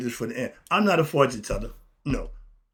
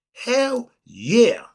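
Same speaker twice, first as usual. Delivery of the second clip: higher and louder, punched out; emphatic shout; 8 semitones higher, 7 dB louder than usual. Real, not acted. Answering whether it gets worse, loud emphatic yes. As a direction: slam the answer home.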